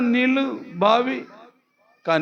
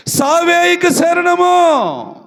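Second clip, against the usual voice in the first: higher, louder, very slow wail; anguished cry; 8 semitones higher, 5 dB louder, much slower than usual. Acted. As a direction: cry out that only death remains. Hagar's despair retold.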